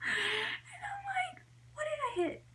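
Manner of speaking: high, squeaky words squeezed through laughter; helpless laughter. Real, not acted. Retelling her panic at hitting something, helpless high-pitched laughing. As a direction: barely get it out for laughing.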